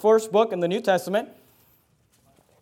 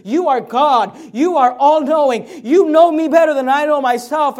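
slow and higher, pleading; humble prayer; 5 semitones higher, 6 dB louder than usual. Acted. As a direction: pray it with earnest surrender. How Peter should have prayed.

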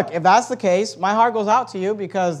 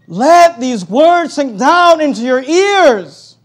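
slow, loud, raised-pitch insistence; emphatic command; 6 semitones higher, 8 dB louder, much slower than usual. Acted. Jesus to distracted disciples, slow emphatic command. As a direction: drive each word home, slowly and loudly.

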